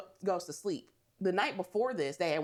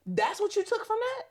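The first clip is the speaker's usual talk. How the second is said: very high-pitched disbelief; real, high incredulous outburst; 3 dB louder than usual. Real, not acted. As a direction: squeal the question, appalled. Outraged at the boyfriend's response.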